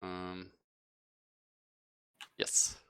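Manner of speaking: ordinary delivery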